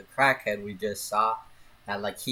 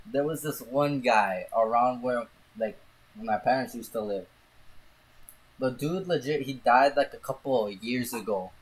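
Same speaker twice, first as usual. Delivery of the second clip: ordinary talk, nothing marked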